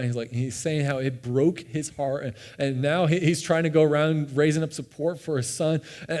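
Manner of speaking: ordinary talk, nothing marked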